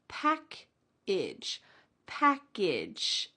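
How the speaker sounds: "Package" said pack-idge, second vowel a short I. Shown as a demonstration. Teacher modeling "Package" with the idge ending. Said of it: "sound like a short I".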